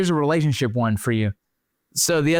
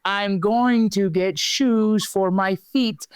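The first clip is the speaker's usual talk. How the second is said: slow deliberate insistence; 6 semitones higher, much slower than usual. Retold or imitated. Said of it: "I'm like".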